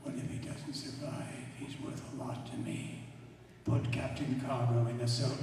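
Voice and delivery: low, husky in-character delivery; film character's line. Acted. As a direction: play the scene in a low, hushed voice. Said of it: "And action".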